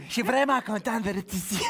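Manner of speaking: lightly put-on Quebec French accent; a touch of Quebec French accent. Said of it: "je suis obligé de faire un accent québécois".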